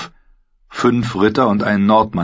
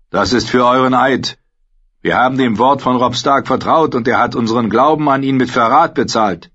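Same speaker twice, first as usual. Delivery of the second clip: angry; 5 semitones higher, 3 dB louder than usual. A narrator's reading. Knight accuses after spitting.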